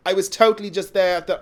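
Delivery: put-on Valley girl with a posh Irish accent; attempted posh South Dublin accent. Shown as a demonstration. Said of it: "almost sounds like a Valley girl".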